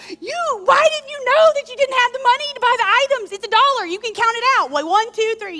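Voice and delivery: a high, loud mock tirade at the customer in line; exasperated rant. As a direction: let the impatience boil over at her. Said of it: "now I'm even more frustrated".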